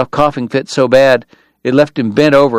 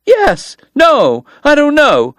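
high, loud flustered woman's voice; flustered, then demand answers; Kate demanding; 12 semitones higher, 3 dB louder than usual. Acted. Kate demands why Doc is there, high and flustered. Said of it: "she demanded".

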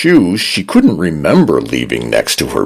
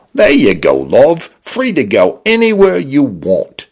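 Cockney-leaning British; 9 semitones higher than usual. Acted. Condo's British voice frees her.

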